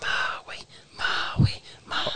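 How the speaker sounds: hushed whisper of a name, repeated; whispered cheering. Retold or imitated. Man retelling Moana cheering Maui on, imitated whisper. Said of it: "Imagine me just whispering to myself".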